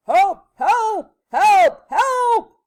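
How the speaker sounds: loud, high bursts of panic; repeated full-voice cries; yelling. A narrator's reading. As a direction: bellow for help like a frantic storybook man. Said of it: "running around in circles yelling".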